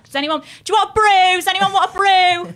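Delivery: loud, high Mancunian call-out; full Manc. Retold or imitated. Daughter offering tea in parents' living room, loud broad Mancunian. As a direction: holler it in broad Manchester accent. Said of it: "I'm, like, full mank".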